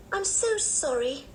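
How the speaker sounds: put-on high, slow child's apology; apologetic little girl. A narrator's reading. Anne apologising to Dick for yawning, high apologetic child. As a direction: draw out a high-pitched girlish sorry.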